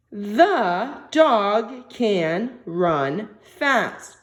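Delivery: each word spaced out, very slow; very slow dictation. Shown as a demonstration. Teacher to young students, dictating the sentence very slowly. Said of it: "So go back".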